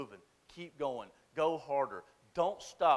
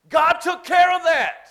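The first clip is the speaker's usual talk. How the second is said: slow, higher, louder proclamation; preaching shout; 19 dB louder, slower than usual. Real, not acted. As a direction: hammer it home, slow and loud.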